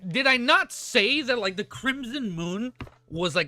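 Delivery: high, loud and drawn out, thrilled to be right; slow, loud crowing over a called prediction; excited. Real, not acted. Streamer crowing to chat that his theory was right, high and loud.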